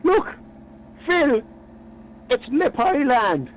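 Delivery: high, slow, savoring reveal; punchline. Acted. Eric's punchline, slowly.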